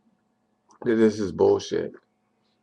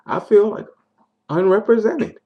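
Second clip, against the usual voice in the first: frustrated; 9 semitones higher, 4 dB louder than usual. Real, not acted. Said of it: "I'm angry".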